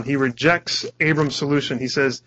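ordinary talk

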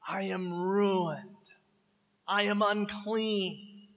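quiet slow confession; slow lament. Acted. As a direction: let it sink in slowly and quietly. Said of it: "someone on the earth goes".

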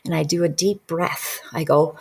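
normal talking voice

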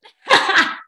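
a short high giggle. Real, not acted. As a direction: giggle lightly, amused. Host laughs again.

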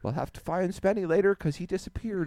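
high, light, puzzled party guest; playful mock bewilderment. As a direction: act innocently confused about who vanished. Acting friends noticing him gone.

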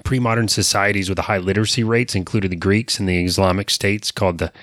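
ordinary talk, nothing marked

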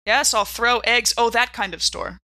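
ordinary talk

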